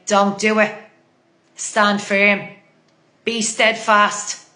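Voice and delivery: normal talking voice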